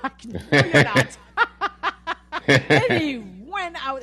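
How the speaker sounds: loud words swamped by laughter; laughing hard. Real, not acted. Owner retelling her dog bolting out, laughing hard. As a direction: try to talk through a big laugh.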